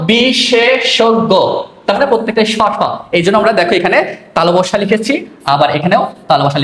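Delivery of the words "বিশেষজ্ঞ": বিশেষজ্ঞ as bisheshoggo, drawn out very slowly; standard pronunciation. Shown as a demonstration. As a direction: stretch বিশেষজ্ঞ clearly. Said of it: "উচ্চারণ করেছি প্রমিত". (প্রমিত উচ্চারণে টেনে বিশেষজ্ঞ)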